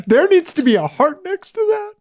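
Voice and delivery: excited. Real, not acted. Excited demand for a like.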